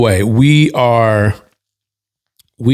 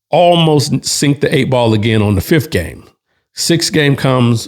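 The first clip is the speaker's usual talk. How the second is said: ordinary delivery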